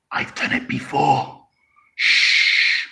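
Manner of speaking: whispered confession, then a long shush; playful hushed admission; mock-secret whisper. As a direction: confide it in a slow whisper. Mock secret confession about schnapps in tea.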